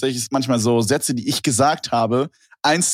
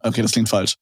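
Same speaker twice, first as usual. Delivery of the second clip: ordinary talk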